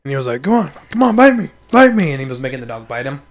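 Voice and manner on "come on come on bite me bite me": higher, louder goading; insistent mock taunting, pitched up; imitated dog trainer. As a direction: egg the dog on in mimicry.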